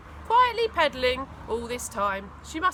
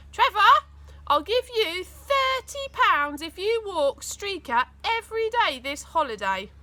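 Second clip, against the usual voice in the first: sweet-talking mum; 8 semitones higher, 5 dB louder than usual. A narrator's reading. Mum sweet-talks her son into walking the dog. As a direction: dangle the bribe sweetly.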